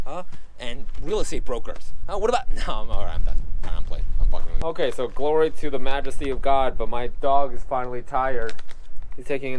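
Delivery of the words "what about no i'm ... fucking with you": ordinary delivery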